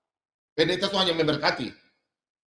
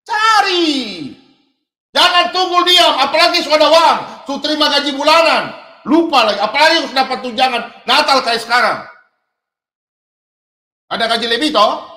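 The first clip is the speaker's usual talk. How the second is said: loud, higher fervent exhortation; fiery sermon exhortation; preaching at full force; 5 semitones higher, 7 dB louder than usual. Acted. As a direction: drive it home to the congregation. Preacher exhorts congregation loudly.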